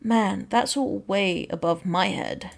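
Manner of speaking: ordinary talk, nothing marked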